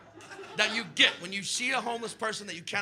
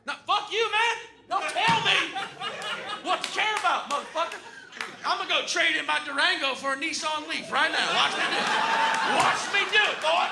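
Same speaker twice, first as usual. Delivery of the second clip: mock defiant yell; 8 semitones higher than usual. Comic voicing an American who refuses to be told anything, yelling.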